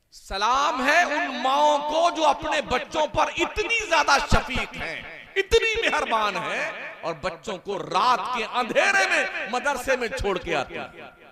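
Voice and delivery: loud, high shouted salute; fiery praise. Acted. Preacher saluting devoted mothers, shouted with full force. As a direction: proclaim it to the whole hall.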